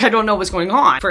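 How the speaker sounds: normal talking voice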